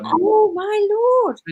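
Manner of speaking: surprised. Real, not acted. Target hit, shocked.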